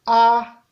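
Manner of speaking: A held level at mid pitch. Shown as a demonstration. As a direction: say A on an even middle tone. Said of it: "Ton moyen". (« A » au ton moyen)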